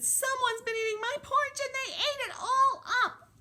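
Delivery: very high, wailing little bear; tiny high-pitched bear in distress; Baby Bear voice. Acted. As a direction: squeal it like a dismayed baby bear. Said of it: "And Baby Bear said".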